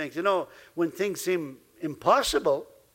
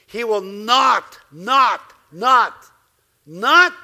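each not louder, pressed harder and harder; not repeated, building; 6 dB louder, much slower than usual. Real, not acted. Preacher to his congregation, not building from firm to loud. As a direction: drive the not home, building it up. Preacher hammering a promise home.

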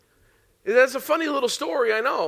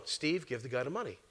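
still small voice; 9 semitones lower, 14 dB quieter than usual. Retold or imitated. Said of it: "still small voice".

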